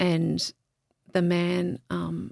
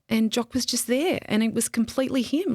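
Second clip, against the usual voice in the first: ordinary delivery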